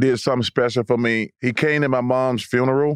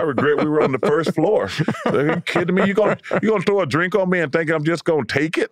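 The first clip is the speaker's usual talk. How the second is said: laughing, higher mock outrage at his own past self; mock indignant retort; 4 semitones higher than usual. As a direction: ham up the old defiance with a laugh. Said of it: "I said".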